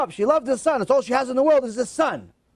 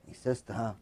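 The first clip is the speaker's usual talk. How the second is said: normal talking voice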